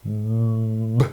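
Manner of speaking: "b" as a long voiced hum before release; isolated sound. Shown as a demonstration. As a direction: hold the "b", then let it pop. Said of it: "on entend b".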